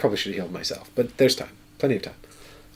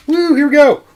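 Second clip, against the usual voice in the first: much higher, louder cheer; excited whoop; 10 dB louder than usual. Real, not acted. Player drawing a card to the table, excited whoop.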